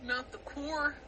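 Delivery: quietly mimicking a Californian "Not the core"; murmured California accent impression. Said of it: "California accents are very funny".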